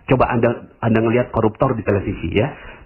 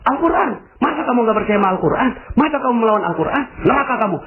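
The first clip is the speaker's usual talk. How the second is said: very high, rapid mock scolding; hectoring accusation; 12 semitones higher, faster than usual. Zealot condemns someone for defying the Quran, hectoring. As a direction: fire off high, quick accusations. Judgemental believer acted out.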